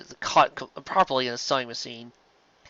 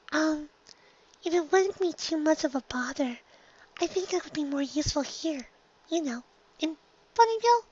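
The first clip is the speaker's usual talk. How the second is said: much higher, softer shy little voice; Fluttershy timid; 5 dB quieter than usual. Acted. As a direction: barely dare to speak up.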